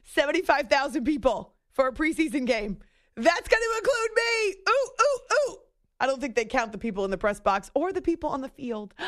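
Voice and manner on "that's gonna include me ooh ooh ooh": high-pitched, giddy outburst; excited squeal. Real, not acted. Host thrilled to be at the game, squealing excitedly. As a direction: squeal it high, with eager little oohs.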